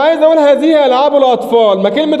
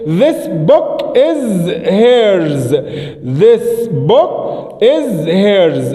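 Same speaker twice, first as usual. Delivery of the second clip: very slow drawn-out model, lower at the end; slow model sentence; 4 semitones lower, much slower than usual. Shown as a demonstration. Teacher modelling the example slowly for pupils. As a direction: say it word by word. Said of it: "أقول إيه؟".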